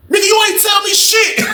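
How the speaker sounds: very high retold squeal; panicked denial.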